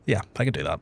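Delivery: normal talking voice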